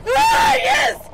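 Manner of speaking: high, loud shriek. Real, not acted. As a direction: let out a sudden shriek. Sudden shout.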